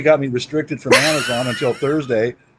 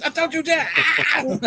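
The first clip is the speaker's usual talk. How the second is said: high squeaky voice; 4 dB quieter, much slower than usual. Pretend. Teasing high voice.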